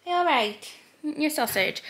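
high, soft cooing. Real, not acted. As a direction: coo gently like to a pet. Cooing at a pet or baby.

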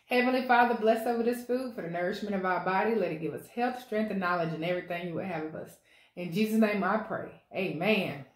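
soft, reverent grace before eating; prayer. Real, not acted. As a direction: pray quietly over the food. Says prayer over food.

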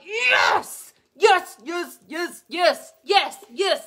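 high, loud ecstatic cheering of yes; excited yelling. Retold or imitated. Nurse educator cheering a student's right answer, ecstatic shout. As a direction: cheer like you just won. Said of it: "And then I'm like".

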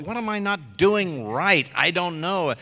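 exasperated. Acted. Actor reliving endless takes in the studio, exasperated. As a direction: plead as if stuck on take after take. Acts out frustration over many takes.

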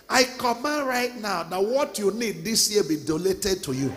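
loud, booming sermon climax; preaching at full force. Acted. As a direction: bring the house down with a thundering sermon.

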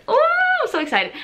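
squealing excitement; excited squeal. Real, not acted. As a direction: burst out with glee.

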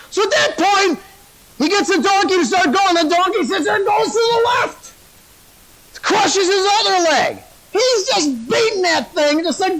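high-pitched, racing sermon storytelling; full-force preaching. Acted. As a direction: preach the action at full tilt.

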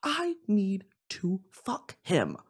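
slow whispered confession; hushed lustful whisper. Real, not acted. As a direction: whisper it like a guilty secret. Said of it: "confessing lust".

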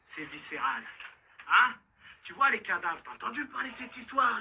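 normal talking voice

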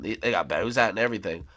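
ordinary talk

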